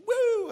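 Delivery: high, loud mock siren whoop; preacher mimicking a police siren, loud and high. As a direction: imitate the cop car's siren. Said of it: "So he's like".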